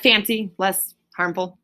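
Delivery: usual speaking voice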